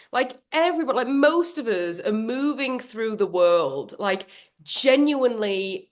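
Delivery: ordinary talk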